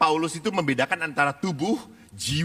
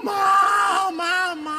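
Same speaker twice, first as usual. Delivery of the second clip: loud, high exaggerated bawl; mock child wailing; 5 dB louder, much slower than usual. Priest mimicking children picturing their mothers, mock wailing. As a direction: burst into a hammed-up children's wail. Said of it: "membuat anak-anak terharu gampang".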